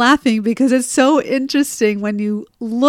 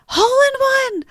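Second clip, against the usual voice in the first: put-on high, delighted child calling out; high-pitched happy shout of a boy; excited kid call. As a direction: sing it out like a proud kid. Son calls out his golf success.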